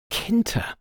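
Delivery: high-pitched, surprised question; assistant's startled query; astonished guess. A narrator's reading. Assistant astonished at culprit's name.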